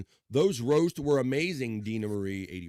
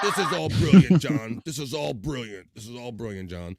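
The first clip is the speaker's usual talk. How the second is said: sarcastic; 7 dB louder than usual. Retold or imitated. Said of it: "It might have been sarcasm".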